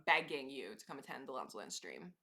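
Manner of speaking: ordinary talk, nothing marked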